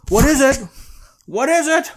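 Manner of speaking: high, loud, frantic actor imitation; hammed-up panicked movie detective; Brad Pitt. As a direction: demand it in mock desperation. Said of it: "Brad Pitt's just like".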